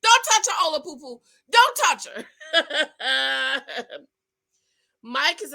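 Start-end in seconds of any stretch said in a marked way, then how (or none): 0.0-2.2 high yelled don't touch her; screaming warning. Retold or imitated. Vlogger screaming at the TV during her live, high and loud. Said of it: "I was screaming". 2.3-4.0 laugh. Real, not acted. Laughing after the mock screaming.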